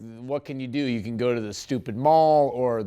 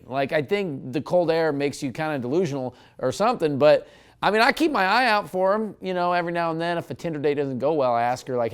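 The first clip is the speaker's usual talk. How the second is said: ordinary delivery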